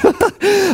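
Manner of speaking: a quick high-pitched chuckle; a short incredulous laugh. Real, not acted. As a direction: laugh briefly in disbelief. Laughing at the pastor's admission.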